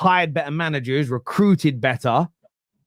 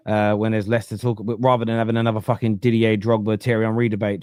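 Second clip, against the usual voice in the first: usual speaking voice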